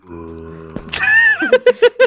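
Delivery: high, loud burst of laughter. Real, not acted. Player joking at bedtime, bursting into loud laughter. Joking while lying down to sleep.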